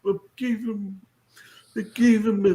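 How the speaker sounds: halting mock mob-boss mumble; Marlon Brando. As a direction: speak as if cheeks are stuffed with cotton. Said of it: "cotton balls when he was speaking his lines".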